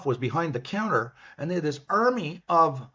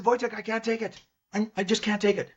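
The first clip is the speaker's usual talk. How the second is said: pleading despair; 8 semitones higher than usual. Retold or imitated. Begging to be flown home.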